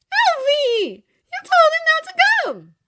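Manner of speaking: very high, loud accusation; accusing shout. A narrator's reading. Glinda accusing Elphaba across the table, loud and high. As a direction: yell at her friend in outrage.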